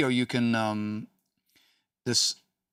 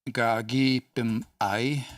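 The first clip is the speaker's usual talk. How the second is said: very slow demo; much slower than usual. Shown as a demonstration. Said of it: "teacher repeats the phrase".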